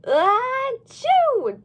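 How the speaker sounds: high, loud fake achoo; loud sneeze. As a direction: sneeze it out big. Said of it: "Dylan sneezed".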